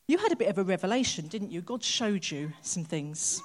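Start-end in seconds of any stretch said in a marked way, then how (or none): none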